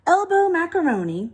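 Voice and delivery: ordinary delivery, nothing marked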